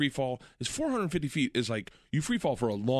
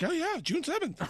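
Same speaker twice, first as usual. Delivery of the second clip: boss's chirpy reply; 14 semitones higher, 3 dB quieter than usual. Retold or imitated. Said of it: "She's like".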